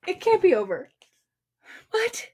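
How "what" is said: ordinary delivery, nothing marked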